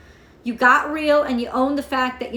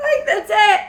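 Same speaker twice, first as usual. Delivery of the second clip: words laughed out, high and louder; laughing; 8 semitones higher, 6 dB louder than usual. Real, not acted. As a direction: laugh through it. Laughing after quote.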